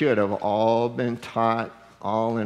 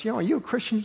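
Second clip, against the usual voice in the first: a hint of a higher, curious cashier voice; asking sweetly, lightly imitated; lightly voiced checkout lady; 10 semitones higher, much faster than usual. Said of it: "she goes".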